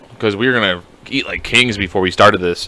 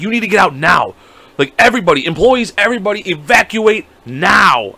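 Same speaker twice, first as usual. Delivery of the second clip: retold evacuation order; 6 semitones higher than usual. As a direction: imitate staff urgently clearing everyone out. Retold staff ordering customers out.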